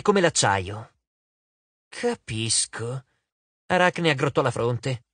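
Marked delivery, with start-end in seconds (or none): 1.9-3.0 very slow, low and soft; pondering murmur. A narrator's reading. Arachne weighing Annabeth's plan, slow and quiet. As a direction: draw it out softly while thinking.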